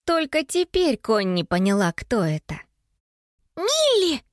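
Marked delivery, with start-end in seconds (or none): 3.6-4.2 high-pitched call of a name; high, startled calling out; shouted. A narrator's reading. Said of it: "закричала Анника".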